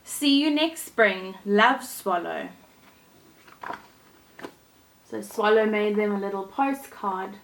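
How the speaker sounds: gentle storybook reading voice; storyteller reading a fairy tale to kids; story narration. A narrator's reading. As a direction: read it like a bedtime story for children. Reads Thumbelina story aloud.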